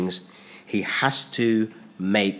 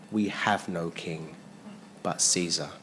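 priests answering; 6 semitones lower, 9 dB quieter than usual. Retold or imitated. Chief priests answer Pilate, soft and low. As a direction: answer quietly and gravely. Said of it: "The chief priests answered".

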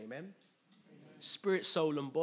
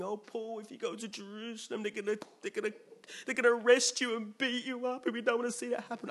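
pleading friends; 7 semitones higher than usual. Retold or imitated. Friends begging Paul not to go.

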